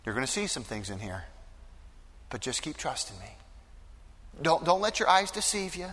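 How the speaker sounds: hushed tender encouragement; gentle reassurance. Acted. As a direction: lean in close to a frightened father and reassure him. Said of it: "Jesus is gonna take them closer".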